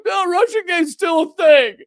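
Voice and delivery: mock-cry like a clinging fan who can't let go; mock whiny newspaper. Mocking the New York Times clinging to Russiagate, fake-crying whine. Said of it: "continue to be like".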